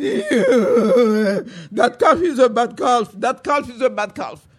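slow, loud sobbing lament; crying complaint. Acted. Buki crying in bed at night, slow sobbing lament. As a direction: whimper it like a hurt crybaby. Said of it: "Buki was going".

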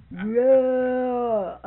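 hollered yeah. Retold or imitated. Silas finally hollering yes to the sop, a loud drawn holler. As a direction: bellow it out all at once. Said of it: "when he hollered out".

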